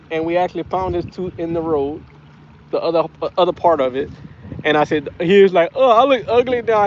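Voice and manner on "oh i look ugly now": high, loud imitation of a crying child; retold tearful complaint, pitched up; mock crying. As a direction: wail it like an upset little boy. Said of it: "He was crying".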